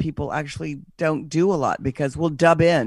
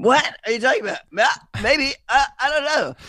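high, far-off caught-out voice, stammering; flustered liar; 9 semitones higher, 3 dB louder, faster than usual. Retold or imitated. Interviewee caught by the sex question answers, high and flustered.

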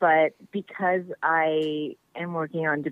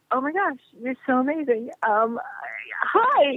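starstruck; 7 semitones higher than usual. Retold or imitated. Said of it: "I went".

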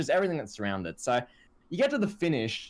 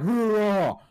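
mock wrestler roar, pitched up and louder; imitated roar; 5 semitones higher, 5 dB louder than usual. Wrestling fan mimicking what viewers heard in the dark, mock roar. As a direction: do a big growly roar like Roman Reigns. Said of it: "like, roaring".

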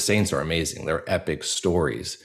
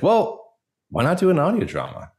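mother's suggestion, pitched higher and quicker; imitating mom; 10 semitones higher than usual. Son retelling his mother's suggestion, higher mock mom voice. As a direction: lightly mimic his mom's eager idea, voice up high. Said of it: "my mother was like".

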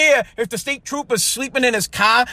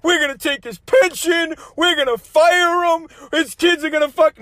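higher, slow, syrupy fake-sorry politician voice; mock governor apology; 4 semitones higher than usual. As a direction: parody a governor's insincere press apology. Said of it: "Governor's gotta come out and".

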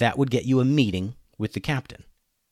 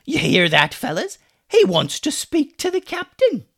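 mocking pirate; 13 semitones higher, 4 dB louder than usual. Pirate mocks to her crew.

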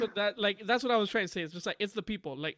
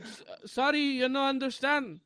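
higher, slow fake foreign accent; put-on foreign English; 5 semitones higher than usual. Mom feigns broken English.

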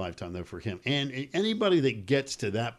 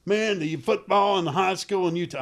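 caller voice; 8 semitones higher, 4 dB louder than usual. Retold or imitated. Utah caller retold.

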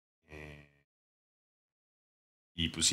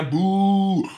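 long, high-pitched boo; mock booing; 12 semitones higher, 5 dB louder than usual. Podcaster booing the player over Germany, mock booing. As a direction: draw out a loud, high boo.

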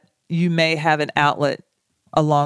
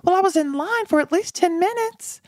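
higher, sheepish self-justifying reply; mock innocent excuse; 12 semitones higher than usual. Wife answering husband about store delay, mock innocent. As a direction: play it innocent and defensive. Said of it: "I'm like".

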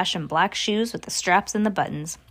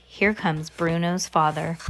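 plain reading voice

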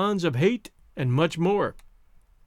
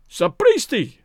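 raised, louder furious outburst; infuriated scream; 5 semitones higher, 5 dB louder, slower than usual. A narrator's reading. Infuriated man rounds on whoever grabbed him, in a furious cry. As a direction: cry out in narrated rage. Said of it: "screamed the infuriated Rokoff".

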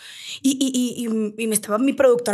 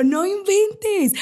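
slow, stretched mock "No inventes", higher; imitating his surprise; 7 semitones higher, much slower than usual. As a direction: parrot his amazed reaction, drawn out. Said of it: "haz de cuenta que te veo". ("No inventes" lento y burlón, más agudo)

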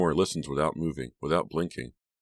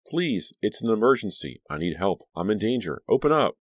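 louder, higher frantic begging; desperate pleading; 6 semitones higher, 5 dB louder than usual. A narrator's reading. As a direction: plead through the door, rising to a shout. Pounding door, begging to enter.

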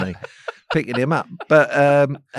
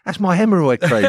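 exclaimed punchline; 7 semitones higher, 6 dB louder than usual. Retold or imitated. Punchline outburst.

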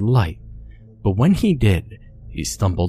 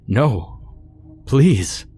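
desperate plea; 5 semitones higher than usual. A narrator's reading. Harry pleading in terror.

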